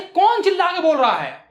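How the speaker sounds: acted high-pitched indignant demand; mock shout; bold loud voice. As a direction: challenge him like a bold old vendor. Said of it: "और bold आवाज में बोलती है".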